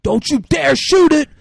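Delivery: very high, very loud yell; shrill hammed-up yell; furious shout. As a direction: scream at the gunman to stop. Rusty Nail yelling at gunman.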